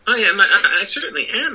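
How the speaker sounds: loud, high flustered confession; flustered admission. Acted. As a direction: blurt it out, caught and embarrassed. Wizard admits shame.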